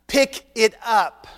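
slow, firmer divine command; insistent God. Acted. God insisting that Moses pick up the snake. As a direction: lay down the order slowly.

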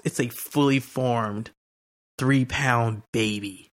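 drawn-out, amazed telling; slow wonder. Retold or imitated. Wife marvels at the baby's size, slow and amazed. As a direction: stretch each word out in awe. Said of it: "she said".

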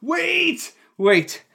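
high-pitched, drawn-out shouts of interruption; retold urgent cry. Player interrupting a somber table, urgent high cry. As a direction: cry out to halt everything, imitating the player. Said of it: "and he's like".

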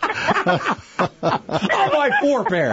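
laughing through the words, very slow. Real, not acted. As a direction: crack up while saying it. Host joking during laughter.

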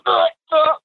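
mock retching. Partner tricked by the peanut butter, mock retching. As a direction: gag like you're about to puke. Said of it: "he's puking".

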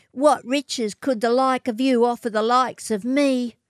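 high, scornful sneer. A narrator's reading. Matilda sneering at the scruffy swagman. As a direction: look down your nose at him. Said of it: "sneered at him".

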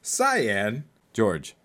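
usual speaking voice

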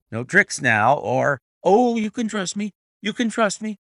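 high, loud frantic assurance; cowed trainer pleading with Holmes, anxious. A narrator's reading. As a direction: beg to be trusted.

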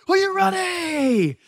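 high, slow hyped-up call to the group; rally them like a pumped instructor; hyped yell. Retold or imitated. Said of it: "he's like".

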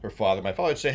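usual speaking voice

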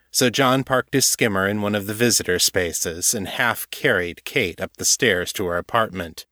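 usual speaking voice

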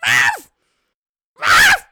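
loud, high barking yelp; fox alarm bark. Shown as a demonstration. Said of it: "then I'm gonna repeat it at you".

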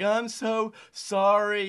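put-on high, slow, tearful little boy; crying kid. Crying young player apologizes to his coach, high and slow. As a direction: mimic a sobbing kid apologizing. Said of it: "he started crying".

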